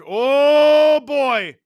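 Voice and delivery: loud, high, stretched exclamation; long, soaring commentator's whoop; excited outburst. Real, not acted. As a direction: react big to a near thing on the ice. Reacts to a play mid-call.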